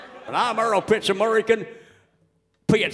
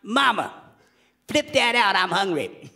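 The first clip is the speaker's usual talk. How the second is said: put-on Louisiana child; 3 semitones higher than usual. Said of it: "they all talk just like this".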